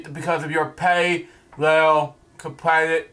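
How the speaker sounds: normal talking voice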